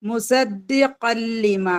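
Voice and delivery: slow recitation of മുസദ്ദിഖൻ ലിമ, nun merged into lam; recited with idgham. Shown as a demonstration. Teacher showing students the merged reading, slow and chanted. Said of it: "നമ്മൾ പാരായണം ചെയ്യുന്ന സമയത്ത്".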